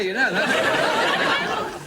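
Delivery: slow, loud drawn-out mock threat; mock menace. Comic teases a front-row fan, slow and loud. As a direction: stretch it out like a joke warning.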